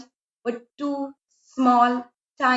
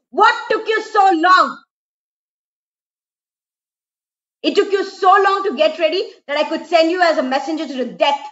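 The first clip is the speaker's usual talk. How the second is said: loud, high, impatient shout at his bride; angry shout; 5 semitones higher, 5 dB louder than usual. Acted. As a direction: bark it out, fed up with waiting. Husband angry at long wait.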